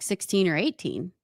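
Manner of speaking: ordinary delivery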